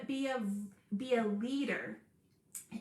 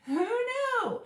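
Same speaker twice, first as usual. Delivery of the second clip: amazed; 12 semitones higher, 6 dB louder than usual. Retold or imitated. Mock amazement after a surprise.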